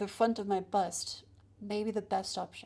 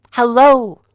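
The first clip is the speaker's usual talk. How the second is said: loud hello right into the mic; 3 semitones higher, 20 dB louder than usual. Shown as a demonstration. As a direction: blast the greeting into the microphone. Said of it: "This is with it directly in my mouth".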